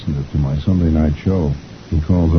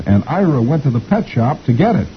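put-on high little-girl voice; 8 semitones higher than usual. A narrator's reading. Little girl tells teacher about Ira, high voice. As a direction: pipe up like a small girl. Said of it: "one of the little girls says".

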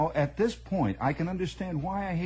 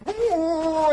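high yelp; 13 semitones higher, 7 dB louder than usual. Retold or imitated. Voicing the squealing car start.

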